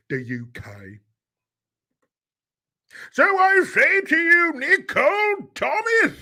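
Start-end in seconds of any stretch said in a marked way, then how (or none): 3.1-6.1 put-on much higher, slow, hammy British lord accent; pompous British lord, done high and grand. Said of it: "when I do the British Lord accent".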